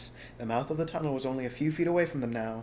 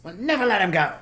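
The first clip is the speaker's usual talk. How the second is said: loud high vow with NEVER stressed; stubborn loud declaration; declared; 8 semitones higher, 9 dB louder than usual. Acted. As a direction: swear it, hitting never. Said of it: "Bunce declared".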